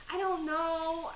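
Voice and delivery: I don't know, shrugging slowly; sheepish shrug. Real, not acted. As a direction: let the shrug drag out. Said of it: "I'm just saying it".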